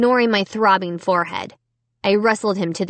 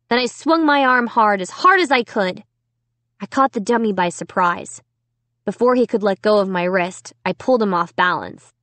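ordinary delivery

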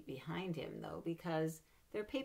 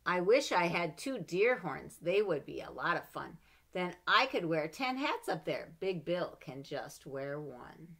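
put-on lively read-aloud for children; story reading; 2 semitones higher, 9 dB louder than usual. A narrator's reading. Mother reading a picture book to her child, lively.

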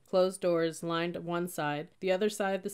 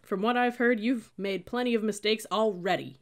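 scornful, higher and louder; snorting sneer; 5 semitones higher, 6 dB louder than usual. A narrator's reading. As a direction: sneer it down at her. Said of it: "he snorted".

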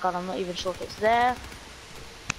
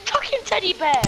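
angry snap; 8 semitones higher than usual. Real, not acted. Gamer cursing at his lost teddy bear, angry shout. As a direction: spit the words out in fury. Gamer furious at teammate's loss.

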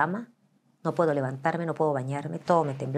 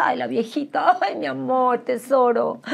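put-on higher, cooing pity for an old lady; mock tender pity, higher and louder; patronizing coo; 6 semitones higher, 4 dB louder than usual. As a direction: talk down to her like a sweet grandma. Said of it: "ya la gente dice".

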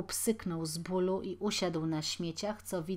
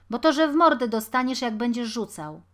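fast, loud threat with raised pitch; threatening; 4 semitones higher, 11 dB louder, faster than usual. A narrator's reading. Taller boy threatening the stone thrower, angry. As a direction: warn him off forcefully.

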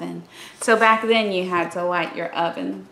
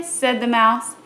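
plain reading voice

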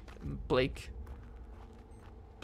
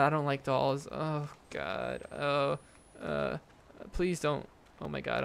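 ordinary talk

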